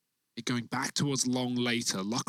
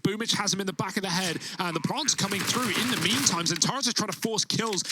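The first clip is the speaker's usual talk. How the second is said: rising hype, faster and higher; excited casting; 4 semitones higher than usual. Real, not acted. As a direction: call the clutch with building excitement. Caster hyping a clutch play.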